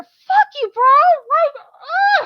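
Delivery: high, loud furious yell; angry shout. Real, not acted. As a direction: yell it at the localizer. Ranting at localizers.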